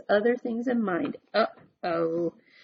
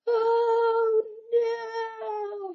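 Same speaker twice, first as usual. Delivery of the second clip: crying out; 13 semitones higher than usual. Acted. Big Anthony flying from the tree, much higher wail. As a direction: wail as he falls. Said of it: "he cried".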